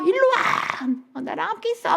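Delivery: high-pitched, acted-out yell after someone; desperate demand. Wife chasing her fleeing husband, desperate demanding cry. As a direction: call him back frantically, hammed-up.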